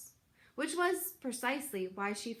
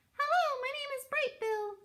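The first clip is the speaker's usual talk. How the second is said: much higher, small cheerful gosling voice; little gosling voice. Acted. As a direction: chirp it like a tiny gosling. Gosling greets a stranger.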